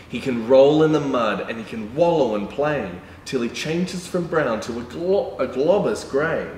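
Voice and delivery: sing-song picture book reading; reading to children. A narrator's reading. Reading a rhyming dog book to children, sing-song. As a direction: read the rhyme to little kids.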